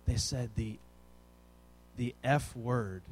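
low, soft, halting reveal; hushed confession. Retold or imitated. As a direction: hesitate before the unspeakable word. Child reveals worst forbidden word.

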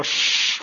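sharp, drawn-out shush; crowd shushing. Retold or imitated. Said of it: "and they go".

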